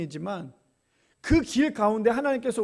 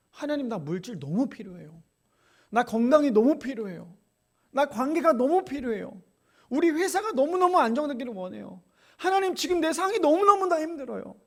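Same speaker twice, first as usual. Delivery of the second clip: pleading prayer rising higher; 7 semitones higher, 3 dB louder than usual. Retold or imitated. Believer pleading with God, rising higher. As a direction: beg God, climbing with each need. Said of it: "그렇게 기도하면서".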